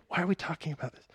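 lightly hammed-up bewilderment; mock-baffled aside. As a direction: toss it off as a puzzled joke.

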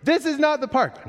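put-on high little-boy complaint; kid complaining. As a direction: imitate a preschooler whining in the store. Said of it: "guess what happens".

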